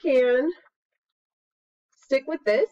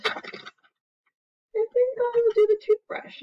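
playful; 12 semitones higher, much slower than usual. Acted. Teacher choosing a brush playfully.

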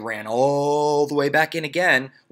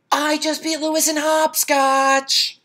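high, loud, slow boast; high proud schoolgirl announcement; proud girl announcing; 7 dB louder, slower than usual. Acted. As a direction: show off the win to friends. Jenny announces her win.

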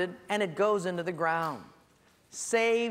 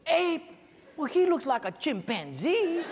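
comic retort; 9 semitones higher than usual. Retold or imitated. Joking reply to wife.

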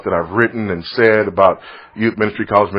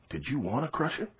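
put-on hushed child's question; 16 dB quieter than usual. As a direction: ask it softly so as not to break the silence. Son asks quietly in bed.

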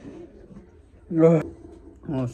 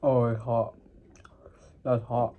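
talking through burning hot food; words round a hot bite; mouth full of hot food; 3 semitones lower than usual. Real, not acted. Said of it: "I can't swallow this".

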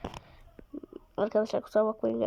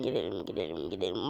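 much lower, softer put-on cartoon voice; goofy low voice; 10 dB quieter than usual. Urging his team to move, goofy low voice. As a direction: growl it like a silly monster.

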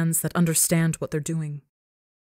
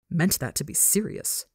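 ordinary delivery

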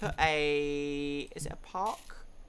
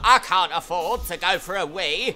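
mock upset guest; 7 dB louder than usual. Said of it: "everyone's like … Very upset".

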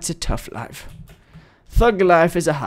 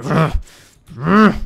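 angry growl; 4 dB louder than usual. Pretend. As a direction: growl out mock rage. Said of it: "I'm so angry".

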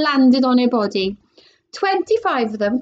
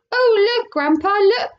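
put-on higher, bright child's cry of wonder; excited cry; 10 semitones higher, 3 dB louder than usual. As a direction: call grandpa over in delight. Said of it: "cried Charlie".

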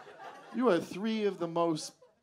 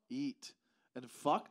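usual speaking voice

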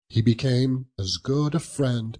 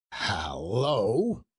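lightly put-on grumpy boss's hello; feigned growl. A narrator's reading. Boss catching his late clerk, mock growl. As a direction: growl it a little, pretending to be cross. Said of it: "growled Scrooge in his accustomed voice, as near as he could feign it".

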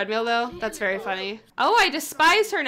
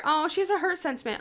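usual speaking voice

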